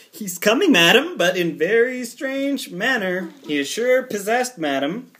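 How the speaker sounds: a maid rushing in with alarming news; excited maid. Acted.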